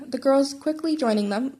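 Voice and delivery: usual speaking voice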